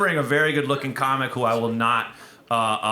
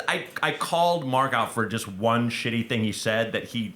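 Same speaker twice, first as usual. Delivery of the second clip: ordinary talk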